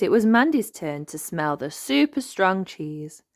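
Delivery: plain reading voice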